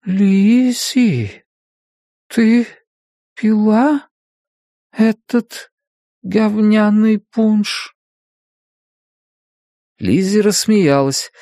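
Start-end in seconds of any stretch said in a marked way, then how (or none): 0.0-7.9 drowsy half-asleep voice. A narrator's reading. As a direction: murmur as if not yet awake. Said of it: "очень тихо, очень медленно, словно ещё не проснувшись".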